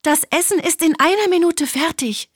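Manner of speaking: high, quick called reply; calling out. A narrator's reading. Hen promises the duck food, calling out hurriedly. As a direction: call out hurriedly to the hungry.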